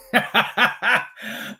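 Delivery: laughing to keep from crying; rueful laugh. Real, not acted. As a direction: burst of helpless, rueful laughter. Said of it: "I'm laughing because I'm trying not to cry".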